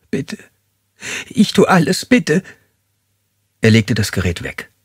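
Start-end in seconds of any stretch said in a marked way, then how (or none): 0.1-2.4 high, quivering begging; trembling plea. Acted. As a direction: voice shaking as she pleads for mercy. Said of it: "das Beben in ihrer Stimme war neu". (zitternd und hoch flehend)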